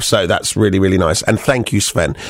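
ordinary talk, nothing marked

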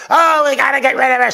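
mock her whining; mock Hillary Clinton. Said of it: "Hillary Clinton getting in".

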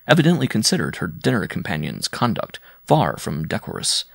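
plain reading voice

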